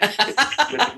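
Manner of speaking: laughing through the words. Real, not acted. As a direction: breaks into a high laugh. Laughs at the accent question.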